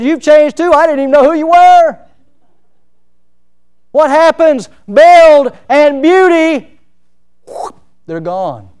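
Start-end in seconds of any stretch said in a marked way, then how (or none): 0.0-2.0 high, loud teasing comeback; excited reply. Retold or imitated. Retold ballpark meeting. 3.9-6.8 high, loud, slow preacher's cry; preaching at full force. Acted. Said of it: "preaching climax".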